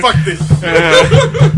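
high, slow laughing curse. Real, not acted. Laughing in disbelief.

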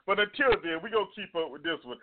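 ordinary delivery

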